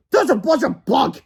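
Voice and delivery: brushing off the ghost; scoffing yell. Acted. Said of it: "yelled Mister Crump".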